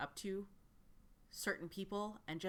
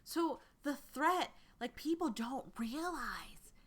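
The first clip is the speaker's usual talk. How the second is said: high, slow, conspiratorial pitch; eager recruiter voice; 8 semitones higher, slower than usual. Acted. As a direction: lean in like sharing a secret. Recruiter explains the threat.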